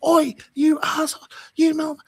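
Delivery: shrieked high and harsh, mocking hysterical women; mock screaming banshee women. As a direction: screech it like a crazed woman in a hotel lobby. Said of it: "banshee women screaming".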